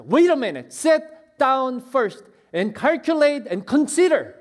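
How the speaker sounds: high, loud, very slow commanding words; preacher thundering Jesus' warning. Acted. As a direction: spell out the demand word by word. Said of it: "예수님이 우리에게 말씀하세요".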